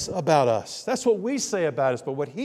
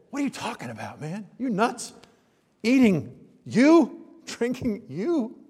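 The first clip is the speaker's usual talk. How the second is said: incredulous grumbler; 5 semitones higher than usual. Acted. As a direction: act out a scandalized heckler. Voicing the grumbling, incredulous crowd.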